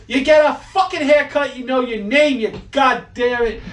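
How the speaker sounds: high, loud, swearing rant; angry old Italian. Retold or imitated. Old Italian guy chewing out the customer, high and loud. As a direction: blast him in a high rage. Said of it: "He goes".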